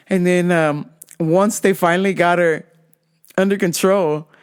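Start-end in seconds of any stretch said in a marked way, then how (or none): none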